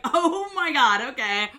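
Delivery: high, loud laughing exclaim; laughing oh my god. Retold or imitated. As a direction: gush through a laugh. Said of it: "And I was like".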